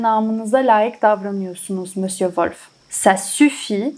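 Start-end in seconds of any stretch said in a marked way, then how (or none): 2.9-3.9 slow and deliberate; slow repeat. Shown as a demonstration. French teacher slowly repeating the line for the class. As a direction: drag it out so learners catch it. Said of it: "teacher repeats the line slowly".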